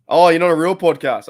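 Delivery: amused exclamation. Real, not acted. After laughing at chat.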